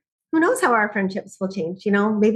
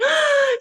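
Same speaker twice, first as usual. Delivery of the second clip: sharp, much higher gasp of amazement; amazed gasp. Retold or imitated. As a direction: gasp as if a miracle just happened. Amazed new mom, baby quiet.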